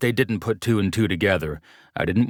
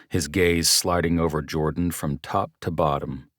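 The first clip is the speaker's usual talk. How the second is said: plain reading voice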